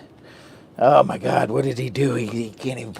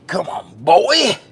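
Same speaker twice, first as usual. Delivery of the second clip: mock onlooker; 7 semitones higher, 8 dB louder, slower than usual. Voicing onlookers scolding him.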